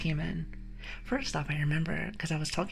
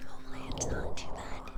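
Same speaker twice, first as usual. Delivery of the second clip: whispering. Shown as a demonstration. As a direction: whisper it close to the mic. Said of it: "how does the audio sound?".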